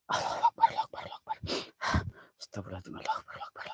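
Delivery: breathy sick whisper; whispered prayer. Real, not acted. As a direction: murmur the takbir weakly.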